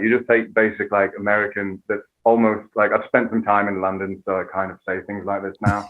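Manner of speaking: slight affected London lilt on American speech; soft, low, lightly Anglicised American; half-British actor murmur; Johnny Depp. Shown as a demonstration. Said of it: "Johnny Depp is just the slight version".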